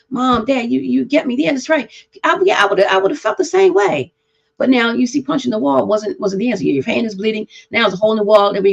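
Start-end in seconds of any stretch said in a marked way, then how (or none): 0.1-4.1 put-on grateful child.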